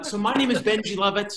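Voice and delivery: ordinary talk, nothing marked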